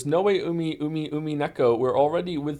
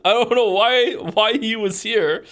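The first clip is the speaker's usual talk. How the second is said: laughing disbelief; 7 semitones higher, 6 dB louder, slower than usual. Real, not acted. Amused disbelief at the clipper.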